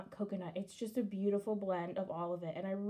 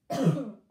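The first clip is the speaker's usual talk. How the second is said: sharp dry cough; coughing on dust; 9 dB louder than usual. Real, not acted. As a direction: cough to clear dust from the throat.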